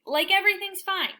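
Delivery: ordinary delivery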